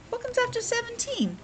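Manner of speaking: high squeaky voice asking the class; teacher playfully quizzing the kids; silly high question. Acted. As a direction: ask it in a funny little cartoon voice.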